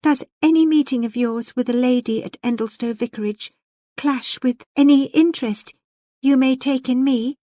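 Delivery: importunate plea. A narrator's reading. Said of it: "she importuned with a trembling mouth".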